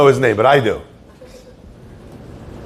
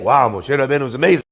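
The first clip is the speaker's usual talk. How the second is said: mock admiration; 8 semitones lower, 3 dB quieter, much faster than usual. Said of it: "in order that we should say".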